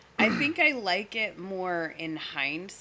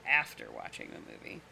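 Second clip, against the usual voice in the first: normal talking voice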